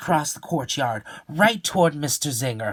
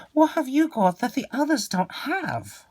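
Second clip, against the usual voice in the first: put-on rich boy voice; 13 semitones higher than usual.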